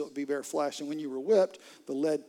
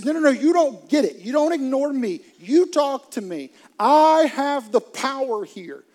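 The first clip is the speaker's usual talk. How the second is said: furious Pilate; 8 semitones higher, 11 dB louder than usual. Retold or imitated. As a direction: hammer home who holds the power. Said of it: "used to being the most powerful person".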